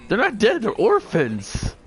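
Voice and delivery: high, loud outraged protest; indignant shout. Real, not acted. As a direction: yell it in disbelief. Friend protests joke about orphans.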